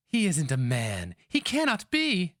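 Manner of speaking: put-on high, rising, incredulous girl; young woman. A narrator's reading. Young girl protesting about the warrior, high and disbelieving. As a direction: refuse to believe it.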